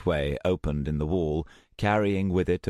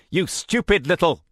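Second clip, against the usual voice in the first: higher, louder threatening insult; angry threat; 12 semitones higher, 6 dB louder than usual. Acted. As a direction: spit it at her, then break off. Threatening step forward.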